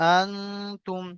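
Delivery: "আনতুম" with the n held as a soft nasal hum; ghunnah. Shown as a demonstration. Teacher showing the hidden nun, long nasal n in "আনতুম". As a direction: hum through the n of "আনতুম" before the t. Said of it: "এখানে গুণনা হবে".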